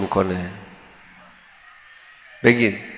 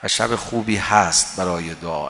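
normal talking voice

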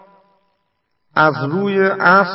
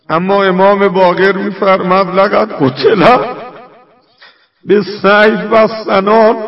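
louder, higher mourning recitation; impassioned lament; 4 semitones higher, 6 dB louder than usual. Real, not acted. Preacher recounting the killing of Hussein to mourners, impassioned lament. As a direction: raise the voice in anguished lament. Martyrdom lament reaches its climax.

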